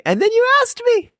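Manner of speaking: very high-pitched, delighted outburst; thrilled amazement. Retold or imitated. Podcaster thrilled to have been asked by co-host, amazed. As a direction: leap way up in excited disbelief. Retelling the surprise invitation.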